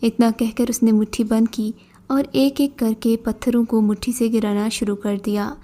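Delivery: ordinary delivery, nothing marked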